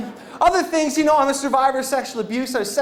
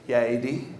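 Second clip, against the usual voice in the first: subdued reply; 13 semitones lower, 7 dB quieter than usual. Acted. Answers buddy's worried call.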